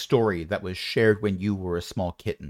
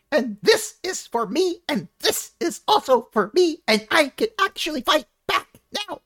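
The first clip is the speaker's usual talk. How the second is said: high gleeful punching voice. Acted. Monster punching the dead dragon skull, high and gloating. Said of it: "X is continuing to punch".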